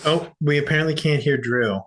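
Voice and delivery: ordinary talk, nothing marked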